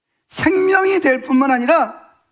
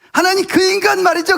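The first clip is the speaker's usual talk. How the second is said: venting to God; 5 semitones higher, 4 dB louder than usual. Acted. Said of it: "이러면서 막 이야기하는 거예요".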